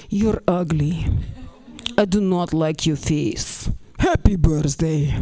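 low, slow Russian deadpan; hammed-up stony Russian girl voice; blunt Russian girl. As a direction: insult them slowly in a low monotone. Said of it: "Russian girl be like".

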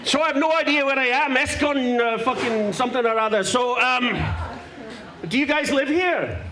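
mock Glaswegian comic voice, played broad; a lightly held Scottish accent; put-on Scottish accent. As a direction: talk like a brash Scotsman fresh off the plane. Holds a put-on Scottish accent until admitting he's London-born.

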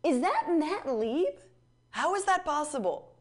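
mock surprise. Mock gasp at the ad.

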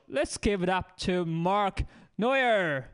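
slow loud emcee announcement; slow emcee intro. Real, not acted. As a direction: stretch the name out like an announcer. Host introducing comic, slow.

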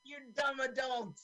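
put-on very high, strained kid voice; bossy kid character. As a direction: squeal orders like an exasperated child. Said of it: "So he's telling him how to do it".

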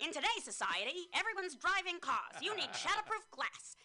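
put-on high, loud old newsreel narrator; old-timey voice. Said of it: "I'm gonna use an old-timey voice".

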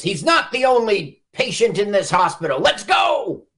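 rallying shout, rising high; [name]. Acted. Retelling a character taking over the hospital, commanding shout. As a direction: take charge and holler the last words.

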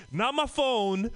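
ordinary talk

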